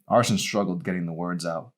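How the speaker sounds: usual speaking voice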